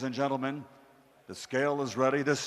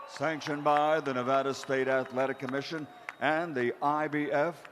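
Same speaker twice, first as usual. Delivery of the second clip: normal talking voice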